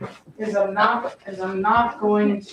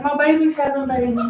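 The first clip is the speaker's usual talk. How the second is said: lightly voiced proud dog, higher and louder; a proud mother dog speaking, pitched up; voicing the dog; 6 semitones higher, 5 dB louder, faster than usual. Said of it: "She's like".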